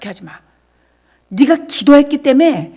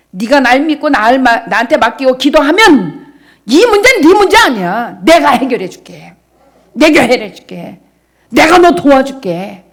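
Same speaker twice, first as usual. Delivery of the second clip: louder, earnest promise; loud preaching; 2 semitones higher, 9 dB louder than usual. Acted. Pastor voicing God's reassurance to believers, loud preaching. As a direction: press God's promise onto the listeners.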